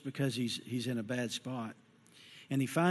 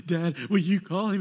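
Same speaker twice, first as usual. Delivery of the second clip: pleading daughter; 4 semitones higher, 6 dB louder than usual. Retold or imitated. Daughter begging dad.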